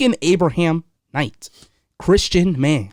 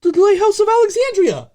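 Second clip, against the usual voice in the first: much higher, louder gloating shout; triumphant yell; 4 dB louder than usual. Real, not acted. Proven right, he shouts at his co-host in triumph. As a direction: crow it at the top of your voice. Repeats the answer he got right.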